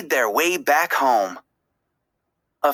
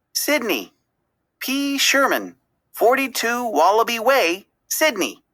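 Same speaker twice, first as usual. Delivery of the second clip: higher, eager burst of memory; excited recall; 7 semitones higher than usual. A narrator's reading. Dory suddenly remembering the address, excited. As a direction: blurt the address as it all comes back. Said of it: "she cried".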